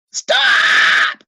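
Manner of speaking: loud, high shout; shouted stop. A narrator's reading. Boy shouting at the coach to stop. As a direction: yell to halt the race. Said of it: "cried Lois".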